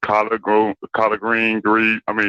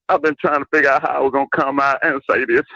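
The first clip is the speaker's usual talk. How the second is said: ordinary talk, nothing marked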